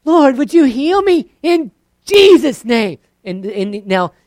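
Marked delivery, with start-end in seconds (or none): 0.0-3.0 loud, high, slow prayer; mock prayer, loud and high; Jesus name prayer. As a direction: put the name last. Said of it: "Or you might go".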